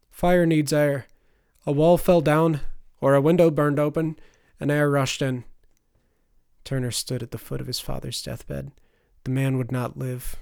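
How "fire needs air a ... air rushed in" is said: explaining doctor. Acted.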